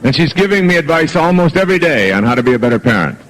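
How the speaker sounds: ordinary delivery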